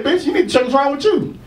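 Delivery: high-pitched retold indignation; indignant comeback. Comic retelling of arguing with a woman, indignant and high.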